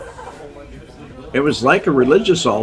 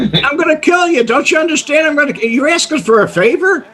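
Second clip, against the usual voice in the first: imitating Sam's threat; 12 semitones higher than usual. Mob boss Sam threatening Kenny in the basement, hammed-up rage. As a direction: ham up a raging mobster.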